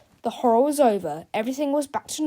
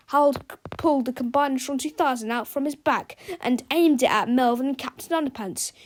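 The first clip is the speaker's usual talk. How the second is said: ordinary delivery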